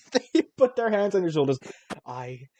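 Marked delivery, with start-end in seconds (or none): 2.1-2.5 much softer, dead serious; hushed confession. Pretend. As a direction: relative confiding a secret, hushed and sincere. Relative confides earnestly.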